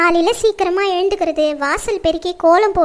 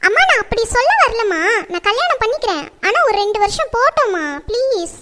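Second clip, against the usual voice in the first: higher-pitched daughterly plea; coaxing, imploring appeal; pleading; 3 semitones higher than usual. Acted. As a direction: beg mother for more time. Daughter begs to delay marriage.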